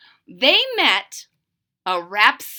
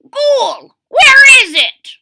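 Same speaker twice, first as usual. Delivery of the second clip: put-on very high, loud old-man holler; old man hollering up at a ghoul; 13 semitones higher, 14 dB louder, slower than usual. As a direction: call out to the ghoul at full voice. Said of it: "hollered out".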